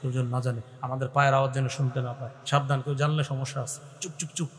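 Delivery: low, soft secretive murmur; hushed. Shown as a demonstration. Preacher shows the brothers hushing each other, softly. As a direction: keep it down like sneaking past. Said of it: "মানে এই যে আস্তে আস্তে বলতে চাই".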